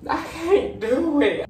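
high drawn-out whine; whiny wail. Real, not acted. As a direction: whimper it like giving up.

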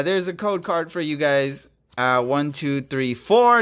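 usual speaking voice

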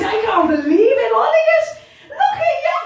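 high, laughing, teary disbelief; overjoyed, near tears. Real, not acted. Quiz host overjoyed as her players smash the quiz, laughing through tears. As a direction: squeal it like you can't believe it. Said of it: "Feel like crying now".

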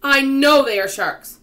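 slow, high, very loud insistence; drawn-out alarmed shout; emphatic insistence. A narrator's reading. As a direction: hammer it out slowly and loudly. Frightened Earthworm insists loudly.

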